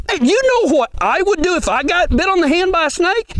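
usual speaking voice

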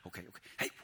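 hurried whisper; steady yourself in a whisper; whispered self-talk. Retold or imitated. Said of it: "that inner dialogue starts coming out like".

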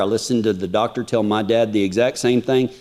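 ordinary delivery

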